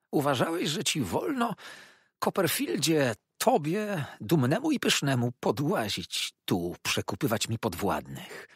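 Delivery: a cornered schemer lashing out; unctuous villain turned spiteful; Uriah Heep. Acted. Uriah's resentful tirade.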